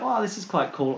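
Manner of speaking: high, excited wow; impressed wow. Retold or imitated. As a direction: marvel at it, lightly. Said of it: "I was like".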